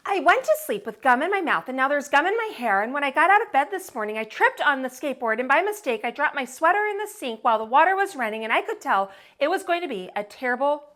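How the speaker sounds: put-on lively read-aloud for children; storytime reading. A narrator's reading. Narrator reading a picture book to kids, lively. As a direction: read it brightly to young listeners.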